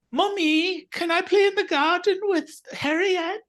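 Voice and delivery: put-on high, sweet British child's voice; little British girl. Granddaughter asking her mom to go play, in a high British child voice. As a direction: put on a small English girl asking mum nicely. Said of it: "You're not British. ... quit talking that way".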